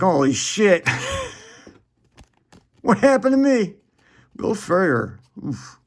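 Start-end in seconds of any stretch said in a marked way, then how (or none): 0.0-3.7 high laughing cry of dismay; mock-despair over what happened; hammed-up high wail through a laugh; dismayed realization. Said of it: "You're like".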